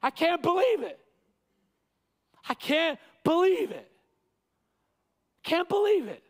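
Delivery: high, stunned disbelief; astonished. Acted. Preacher giving Paul's astonished tone, disbelieving. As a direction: let it hang in shock. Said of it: "that's his tone".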